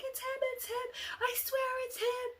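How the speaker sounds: very high, panicked squeal; desperate insisting. Retold or imitated. Girl swearing to the group it's him, frantic squeal. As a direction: plead to be believed in a frantic high voice.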